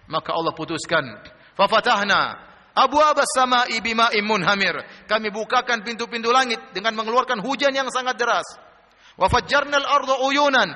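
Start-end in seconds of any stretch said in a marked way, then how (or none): 1.5-4.7 slow, loud, higher recitation; ringing scriptural recital; Quran recitation. Acted. 9.1-10.7 slow, high, loud recitation; stretched-out scriptural recital; Quran recitation. Acted.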